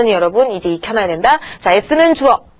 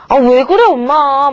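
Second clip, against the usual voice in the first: higher, louder whine at mom; whiny back talk; 4 semitones higher, 3 dB louder, slower than usual. Acted. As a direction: act out a kid protesting to a scolding mother. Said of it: "이런 식으로 대꾸하지 말고". (엄마한테 짜증 내며 대드는 말투)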